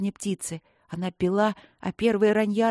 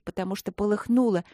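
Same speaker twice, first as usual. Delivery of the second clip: ordinary delivery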